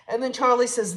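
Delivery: normal talking voice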